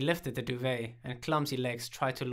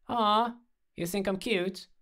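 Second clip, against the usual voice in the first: teasing; 9 semitones higher, 4 dB louder, slower than usual. A narrator's reading. Teasing after being called cute.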